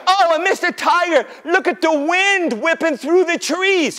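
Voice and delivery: high, urgent warning; feigned alarm. Pretend. Rabbit warning Tiger of the wind, high panic. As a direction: play up the fright to fool someone.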